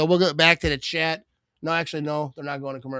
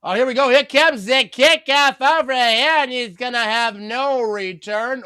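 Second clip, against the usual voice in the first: kickoff call; 6 semitones higher, 4 dB louder than usual. Real, not acted. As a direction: sing out the kickoff like a commentator. Calls the kickoff live.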